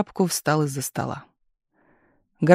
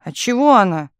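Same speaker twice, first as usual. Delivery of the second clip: higher, louder protest; desperate cry; 4 semitones higher, 5 dB louder than usual. A narrator's reading. Telegraph operator protesting to chief, desperate cry. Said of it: "крикнула в отчаянии".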